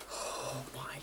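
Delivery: breathy, drawn-out whisper; stunned whisper. Real, not acted. Vlogger reeling from a shocking episode, stunned whisper. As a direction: whisper it slowly, in disbelief.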